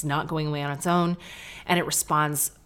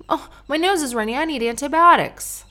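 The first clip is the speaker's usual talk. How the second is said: higher and louder, a hammed-up whiny sick person; mock whiny patient; 9 semitones higher, 6 dB louder than usual. Host mocks people demanding antibiotics, hammed-up whine. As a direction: send up the fussy patient begging for pills.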